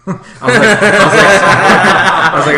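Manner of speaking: loud, high laughter breaking into the words; words swamped by helpless laughing; cracking up. Real, not acted. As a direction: try to talk through a big laugh. Breaking up laughing mid-story.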